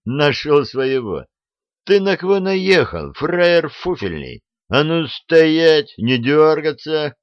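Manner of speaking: high, loud, threatening bark; menacing shout. Acted. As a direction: bark threats at a cornered thug. Prisoner threatening the bandit chief.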